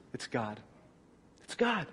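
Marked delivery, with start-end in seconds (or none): 0.1-0.6 轻声压低，敬畏地说“God”; hushed reverent. Acted. Repeats the phrase.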